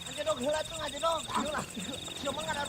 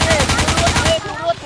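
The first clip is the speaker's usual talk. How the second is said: loud frustrated shout; yelling; 3 semitones higher, 16 dB louder, slower than usual. Real, not acted. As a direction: yell it at a teammate. Gamer yelling at teammate. (teriakan kesal)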